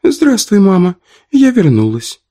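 put-on high child's voice; little girl voice. A narrator's reading. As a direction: answer like a nine-year-old girl. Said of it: "отвечает девочка знакомым голосом".

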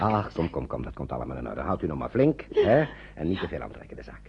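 zacht en laag geruststellend, eindigt fluisterend; troostend. Acted. Oom Rob troost de vrouw. (oom Rob sust de vrouw op het perron)

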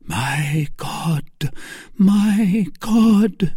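rising, high impassioned plea; praying aloud. A narrator's reading. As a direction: call on God in anguish. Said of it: "began to pray".

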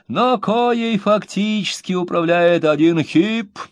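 high, slow self-admiring reading; pompous reading. A narrator's reading. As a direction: savour every grand word. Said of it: "выразительностью его чтения".

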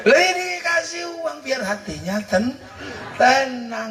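words squeezed out through laughter; laughing high. Real, not acted. (sambil tertawa)

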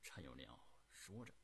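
ordinary delivery, nothing marked

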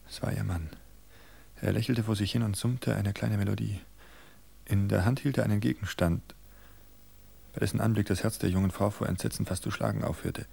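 usual speaking voice

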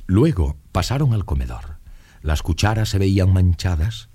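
plain reading voice

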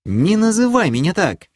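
высоко и громко рявкнуть; оборви брата резким рыком. Acted. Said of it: "рыкнула его сестра". (сердитый громкий окрик)

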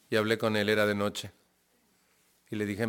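normal talking voice

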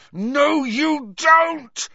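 furious roar, high and loud. A narrator's reading. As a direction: roar it at the cat. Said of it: "roared Harry".

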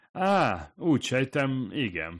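hesitant, starting high and dropping; unsure answer, tailing down from a high "Á"; uncertain. A narrator's reading. Hirai answers the man's question, uncertain. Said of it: "felelte Hirai bizonytalanul".

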